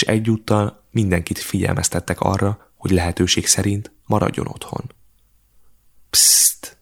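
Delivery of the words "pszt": a sharp whispered shush; whispered hush. A narrator's reading. Beka hushes her brothers, sharp whisper. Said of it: "suttogta Beka".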